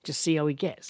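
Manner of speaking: ordinary delivery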